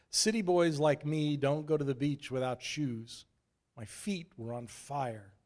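usual speaking voice

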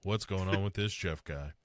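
emotionless, lifeless line reading; monotone bad acting. Host imitating adult Joe chatting with Bev, monotone. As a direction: mimic a wooden actor failing to sound playful. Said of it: "it's real monotone".

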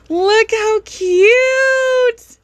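very slow high drawn-out coo; overwhelmed by cuteness. Real, not acted. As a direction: stretch it out, melting at cuteness. Gushing, drawn out.

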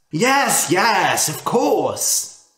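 a quick, eager burst of agreement; eager yes. Acted. Rich man eagerly agreeing to swap, a touch excited. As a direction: snap up the offer with a touch of glee. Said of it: "The rich man was very happy".

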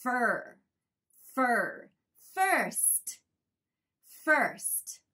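"fur", "first" drawn out with puckered er, pauses between; demonstrated er sound. Shown as a demonstration. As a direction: model "fur" then "first" as separate, careful words. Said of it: "ちょっと口をつぼめて". (口をつぼめて「fur」「first」をはっきり発音)

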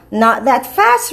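ordinary delivery

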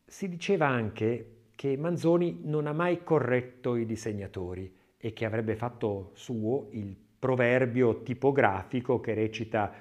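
ordinary delivery, nothing marked

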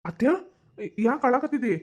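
high-pitched worried question; concerned question. Acted. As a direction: ask with concern why she is upset. Nephew finds his aunt crying.

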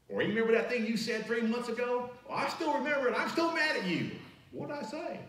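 high, whiny mock grudge; petty grudge voice. Pastor mocking someone who holds grudges, high petty whine. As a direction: play the spiteful record-keeper.

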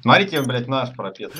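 ordinary delivery

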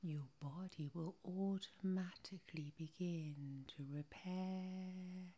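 soft, soothing hypnotherapist voice with drawn-out words; sleep hypnosis. Acted. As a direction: lull the listener gently towards sleep, lingering on words.

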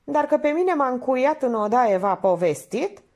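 high, loaded question; ironic. A narrator's reading. Painter asking Minerva about being locked in, ironic. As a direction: press it with pointed irony. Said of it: "făcu ironic pictorul".

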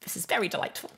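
high, put-on character voice; Mister Whiskers. Streamer repeating the requested catchphrase, in Mister Whiskers voice. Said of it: "in the Mister Whiskers voice".

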